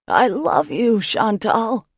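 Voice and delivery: shouting. Acted. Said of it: "my idiot brother shouts".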